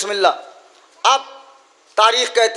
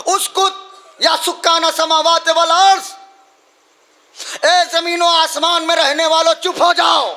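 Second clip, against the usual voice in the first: high, raised call for silence; commanding cry; 6 semitones higher than usual. Acted. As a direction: order the heavens to be still. Zainab commands earth and sky.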